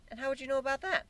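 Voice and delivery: skeptical pony. Acted. Twilight approaching the narrator, skeptical. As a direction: ask it doubtfully.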